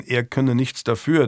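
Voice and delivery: ordinary delivery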